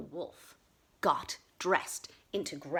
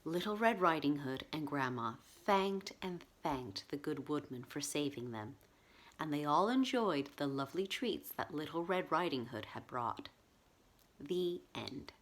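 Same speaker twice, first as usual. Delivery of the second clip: story ending; 4 semitones lower than usual. A narrator's reading. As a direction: wind the tale down gently. Story's closing narration.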